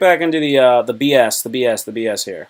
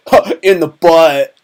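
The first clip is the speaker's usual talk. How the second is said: dude's deadpan quip; 4 semitones higher, 8 dB louder, slower than usual. Retold or imitated. Lacrosse dude's blunt quip.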